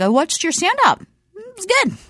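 high, lifted mock of faint praise; voice going up. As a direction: pitch climbing up high on "good". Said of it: "it went up".

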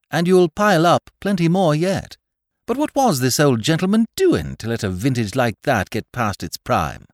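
high, loud, animated connoisseur; excitable gentleman, pitched well up; enthusiastic gentleman. A narrator's reading. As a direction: rattle it off with lofty enthusiasm.

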